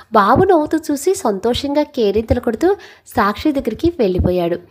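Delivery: ordinary delivery, nothing marked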